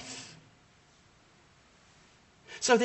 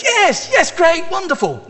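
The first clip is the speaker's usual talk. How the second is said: much higher and louder, hammed-up joy; mock delight; 10 dB louder than usual. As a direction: overplay a gushing yes yes. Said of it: "Zechariah goes".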